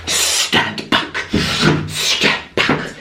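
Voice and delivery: imitating the German lady, whispered stand back; breathy warning to the guests; hushed mock command. Storyteller imitates the German lady warning everyone back, whispered.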